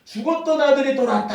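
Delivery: an elated father announcing his son's return; joyful cry. Acted. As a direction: shout it out overjoyed. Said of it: "너무 기뻐하는 겁니다".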